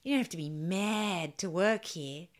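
put-on slow, strong Aussie accent; strong Australian accent. Jokingly voicing the author's slogan in a strong Australian accent. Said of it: "why has he got a really strong Australian accent".

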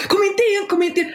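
high, urgent mock panic; panicked plea. Woman retelling shouting to her husband to stay out of the messy kitchen, mock panic. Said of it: "Jag bara".

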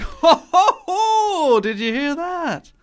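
high, loud hammed-up old theatregoer guffaw; ho-ho it up like a pompous old audience member; mock hearty laugh. Older theatregoer nudging neighbour, mock loud guffaw. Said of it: "older people are just like".

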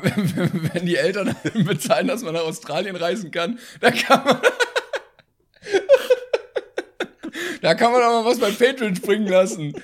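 high, loud, laughing through the words; cracking up while speaking. Real, not acted. Laughing while teasing about a listener who went to Australia.

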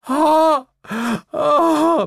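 very high, loud cooing gasp; touched "oh". A narrator's reading. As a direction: gasp "oh" high, moved to tears. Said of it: "machte Lilith, blutige Tränen schimmerten". (hohes, gerührtes Oh)

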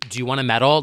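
normal talking voice

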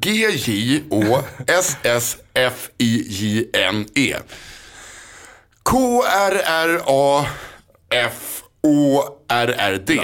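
letters spelled out slowly, one by one; slow letter-by-letter spelling of the name Josefin Crafoord. Shown as a demonstration. Host guesses how cohost will spell the name, slow letters. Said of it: "hur du kommer stava det här nu".

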